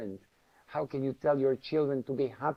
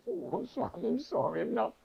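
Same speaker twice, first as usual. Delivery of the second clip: much higher, flustered little voice; sheepish apology; 8 semitones higher than usual. Retold or imitated. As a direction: squeak out an embarrassed sorry.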